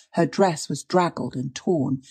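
usual speaking voice

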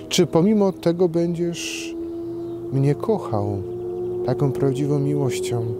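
ordinary talk, nothing marked

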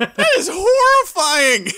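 high, loud hammed-up cry of horror; mock horror. Friend reacts to the comic panel with hammed-up horror. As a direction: recoil in fake terror.

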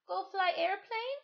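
put-on high, childlike question; a little sister asking hopefully; high little girl's voice; small child. Little Penny asks.

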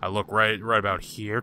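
usual speaking voice

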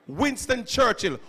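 usual speaking voice

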